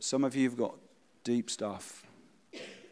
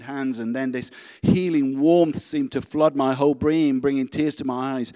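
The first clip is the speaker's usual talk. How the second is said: dramatic reading that swells louder and louder; story reading; 3 semitones higher, 9 dB louder than usual. A narrator's reading. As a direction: read the testimony, building to a loud climax. Reads testimony story aloud.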